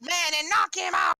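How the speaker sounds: high-pitched shout of encouragement; ringside heckling. Acted. Spectator urging Wilfred to finish the fight, high-pitched heckling shout. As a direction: holler it at your fighter from ringside.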